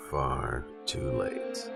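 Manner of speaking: plain reading voice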